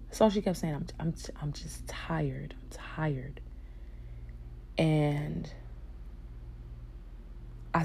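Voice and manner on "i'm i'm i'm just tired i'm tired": soft, breath-voiced echo of a tired mother; weary murmur. Retelling sick Mom's repeated words, wearily soft. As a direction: let the words sink, drained and quiet.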